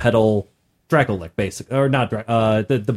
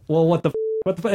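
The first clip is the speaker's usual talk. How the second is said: bewildered; 6 semitones higher, much faster than usual. Retold or imitated. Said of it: "it's the question of, like".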